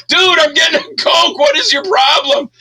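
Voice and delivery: high, loud, near-crying mock whine; whiny protest. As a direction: squeal it like a scared guy who only wanted a Coke. Acted victim's whiny protest.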